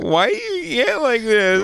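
put-on high drunken complaint; drunk yelling outside. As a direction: whine it up high, slurring.

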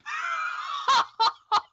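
a big shrieking laugh. Real, not acted. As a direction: burst out laughing. Bursts out laughing at story.

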